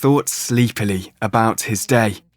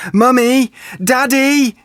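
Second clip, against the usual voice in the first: calling out; 13 semitones higher than usual. A narrator's reading. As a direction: call out to Mummy and Daddy. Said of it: "he called".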